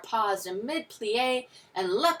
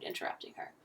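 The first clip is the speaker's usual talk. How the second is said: plain reading voice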